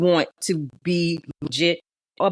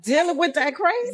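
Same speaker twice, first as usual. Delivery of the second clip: high-pitched teasing incredulity; mock disbelief; 11 semitones higher than usual. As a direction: question it way up high in playful disbelief.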